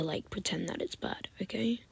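soft, hushed, in on a joke; conspiratorial whisper. Real, not acted. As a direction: whisper it like a secret plan. Conspiratorial aside to viewers.

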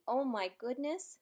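ordinary delivery

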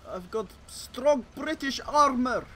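slow, loud mock Russian accent; hammed-up Russian tank commander. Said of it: "For some reason doing Russian accent".

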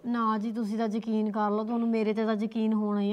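mother quietly begging the elders to believe her daughter; quiet pleading. Acted. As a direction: plead softly for trust.

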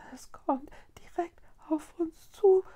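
high, whispered fearful warning; scared stammer. Acted. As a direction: stammer it in terror. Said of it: "stammelte Mila".

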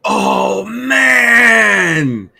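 loud, high burst of real delight; thrilled shout. Real, not acted. Recognizes beloved childhood show.

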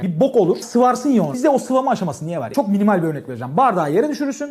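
ordinary talk

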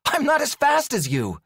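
high, strained calling out; yell. A narrator's reading. Alex hurrying after the cat, yelling. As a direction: call ahead while trying to catch up. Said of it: "she yelled, hurrying to catch up".

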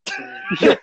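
sudden squeak way up high; a cartoon squeal as he dies; squeaky death cry. Telling a friend about the movie, squeals the death cry. Martians kill the president.

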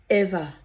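ever punched loud and falling; stress on ever. Real, not acted. To her friends, insisting nothing will ever destroy her love, ever stressed loud. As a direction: hit the word ever hard and bring it down.

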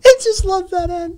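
high, helpless laughing speech. Real, not acted. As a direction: squeeze the words out through real laughter. Cracking up at the clip's ending.

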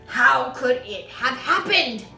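loud, bewildered demand; yelling. A narrator's reading. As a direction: yell the question at the whole room. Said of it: "he yelled".

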